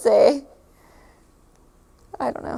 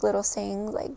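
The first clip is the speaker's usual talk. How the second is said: ordinary delivery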